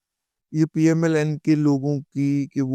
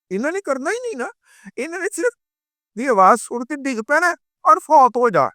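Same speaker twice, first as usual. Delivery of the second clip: much higher, sneering put-on voice; high mocking voice; 9 semitones higher than usual. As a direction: ridicule them in a high mock voice. Mocking the frightened party. (اونچی طنزیہ آواز)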